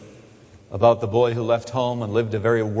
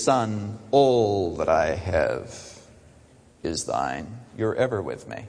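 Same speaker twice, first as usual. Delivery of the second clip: tender father; 6 semitones lower, 3 dB quieter than usual. Acted. Father answering angry son.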